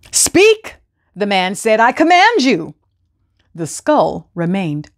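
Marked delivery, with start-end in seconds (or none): 0.1-0.8 high, loud order; commanding. A narrator's reading. Man orders skull. 1.8-2.8 commanding. A narrator's reading. Man orders skull.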